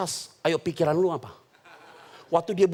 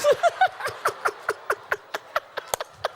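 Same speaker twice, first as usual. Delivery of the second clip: high breathy burst of laughter; high airy giggling laugh. Real, not acted. Congregation joke laugh.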